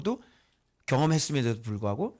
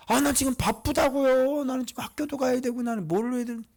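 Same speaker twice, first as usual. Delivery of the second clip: whiny complaint; 11 semitones higher than usual. Acted. Busy church member protesting to the leader, high whiny complaint. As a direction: whine it in a raised, dragging voice.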